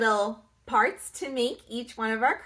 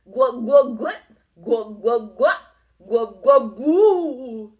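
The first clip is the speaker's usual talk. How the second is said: loud, bubbly glug sounds; gurgling swamp noise; 8 dB louder than usual. A narrator's reading. Narrator makes the swamp bubble, loud.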